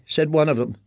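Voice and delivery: ordinary delivery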